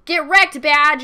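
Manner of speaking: loud gloating yell; triumphant taunt. Real, not acted. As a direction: shout it like you just won. Gloating after raiding friend's base.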